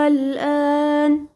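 الآن drawn out slowly in Quranic recitation, with no stress on the doubled sound; recited without stress. Shown as a demonstration. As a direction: chant الآن long and even, with no stress. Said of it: "هنا بقى ما عملتش نبر أنا".

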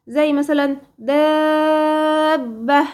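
دابة chanted slowly, the long vowel held and the doubled ب stressed; recited example. Shown as a demonstration. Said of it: "هنا الباء مشددة".

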